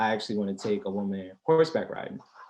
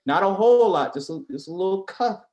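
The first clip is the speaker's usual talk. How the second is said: playful; 8 semitones higher, 6 dB louder than usual. Real, not acted. Excited tease.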